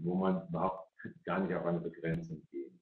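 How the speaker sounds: ordinary delivery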